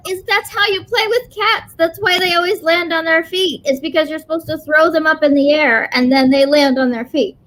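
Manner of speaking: high, bright-eyed dog voice; eager dog. Retold or imitated. Dog insists cats land on their feet, high and eager. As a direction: sell it like obvious dog logic. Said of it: "And he was like".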